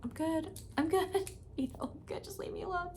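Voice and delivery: thin high tearful voice waving them off; tearful brush-off. Retold or imitated. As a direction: imitate yourself crying but insisting you're fine.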